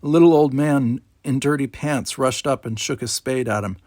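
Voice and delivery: usual speaking voice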